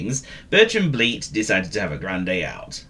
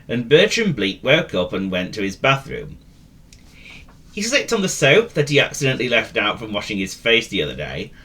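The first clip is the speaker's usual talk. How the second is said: ordinary delivery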